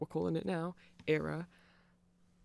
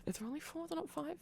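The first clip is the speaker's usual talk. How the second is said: surprised; 12 semitones higher, 9 dB quieter, faster than usual. Real, not acted. Surprised at the picture's count.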